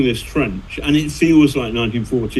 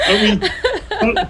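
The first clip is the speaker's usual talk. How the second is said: talking through real giggles; laughing. Real, not acted. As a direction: still giggling while speaking. Still laughing after the joke.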